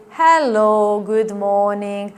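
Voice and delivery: slow, unhurried hello; calm, slow good morning; slow greeting. Shown as a demonstration. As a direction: greet a slow talker at their pace. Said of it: "greet them accordingly".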